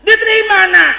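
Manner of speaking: high, loud hopeful question; eager questioning. Acted. Mother asks son if he was accepted, excited. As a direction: burst out asking, as an eager mother.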